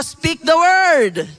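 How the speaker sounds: "Speak the word." loud and slow; emphatic call-and-response; loud repeat. Shown as a demonstration. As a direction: drill it into your neighbour. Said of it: "Sabi mo sa katabi mo".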